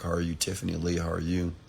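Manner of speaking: normal talking voice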